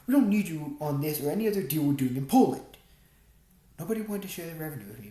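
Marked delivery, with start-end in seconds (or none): 0.0-2.7 loud and dismissive; hostile. A narrator's reading. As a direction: shut him out coldly. Hostile boss rejects narrator.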